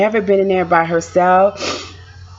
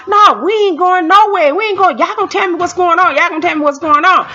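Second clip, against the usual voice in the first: higher, louder insistent husband; retold loud protest; 9 semitones higher than usual. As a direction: push back hard with a raised voice. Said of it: "My husband was getting loud".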